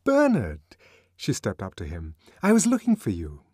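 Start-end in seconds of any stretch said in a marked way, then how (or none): 0.0-0.6 calling out high and clear across a crowd; bright, ringing call across a busy lift. Acted. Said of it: "Her voice rang clear". 2.4-3.4 high and clear, carrying over the noise; clear ringing voice. Acted. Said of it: "Her voice rang clear".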